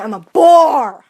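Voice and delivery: loud, drawn-out BORE; hammed-up declaration. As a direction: stretch "bore" into a big mock wail. Exaggerates being dull without caffeine.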